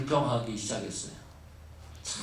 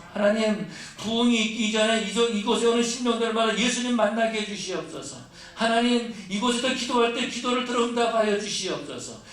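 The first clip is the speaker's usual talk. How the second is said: higher and louder, crying out to God; fervent preaching prayer; 7 semitones higher, 4 dB louder than usual. Acted. Pastor modelling the church's prayer, fervent full-voiced preaching.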